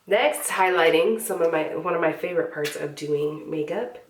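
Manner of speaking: ordinary talk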